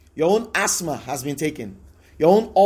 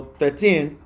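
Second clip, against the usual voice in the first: ordinary talk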